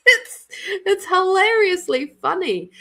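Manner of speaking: high, laughing and breaking up. Real, not acted. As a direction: laugh through the whole line. Laughing at morning hair.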